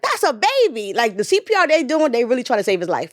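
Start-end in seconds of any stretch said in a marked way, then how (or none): 0.0-2.1 shocked disbelief. Retold or imitated. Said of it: "I'm like".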